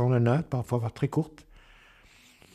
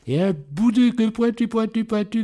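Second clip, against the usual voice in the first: gushing cutesy voice; 10 semitones higher than usual. Acted. Wife gushing over the kitten.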